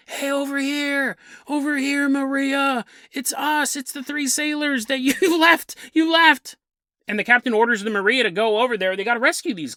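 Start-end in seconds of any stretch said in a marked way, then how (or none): 0.1-6.6 mock calls from a stranded sailor, higher; calling for help.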